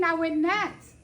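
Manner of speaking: ordinary delivery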